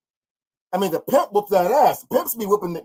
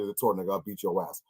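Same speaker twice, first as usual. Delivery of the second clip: low mock threat; 11 semitones lower, 8 dB quieter than usual. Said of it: "And I say".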